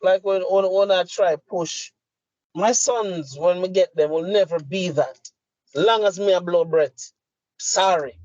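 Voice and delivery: usual speaking voice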